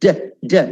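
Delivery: De- as a short, separate "deh"; syllable drill. Shown as a demonstration. Teacher drilling the first syllable De-, pronunciation demo. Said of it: "You say debris".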